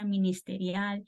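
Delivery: usual speaking voice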